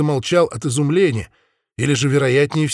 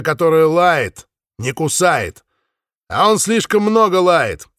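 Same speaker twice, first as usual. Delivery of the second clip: loud; 6 semitones higher, 4 dB louder than usual. Acted. Spaniard mocking the voice loudly.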